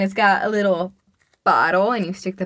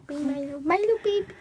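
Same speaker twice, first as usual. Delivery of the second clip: soft, high cooing; pet talk; 5 semitones higher, 6 dB quieter than usual. Pretend. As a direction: coo gently to a pet.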